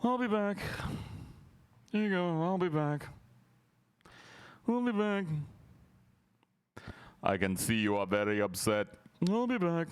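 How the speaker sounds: high mock bored delivery; mocking bored line reading. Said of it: "she yawned her way through that line".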